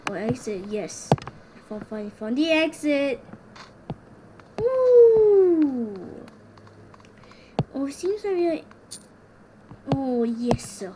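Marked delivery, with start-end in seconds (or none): 4.6-6.2 high, loud victory whoop; cheering. Real, not acted. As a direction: whoop with triumph. Found the exit.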